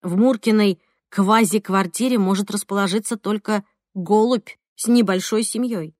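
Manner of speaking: usual speaking voice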